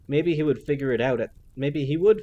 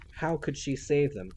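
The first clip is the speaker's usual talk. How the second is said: ordinary delivery, nothing marked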